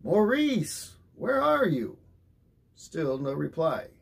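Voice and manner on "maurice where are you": high, loud insistent calling. A narrator's reading. Father calling again for his missing son, insistent and loud. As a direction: call him harder, he still won't answer. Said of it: "more insistently".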